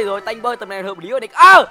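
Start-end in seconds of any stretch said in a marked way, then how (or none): 1.3-1.7 a sudden loud, high yelp; startled cry. Real, not acted. Streamer jumps at a hidden enemy, startled yelp. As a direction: caught off guard, blurt it out.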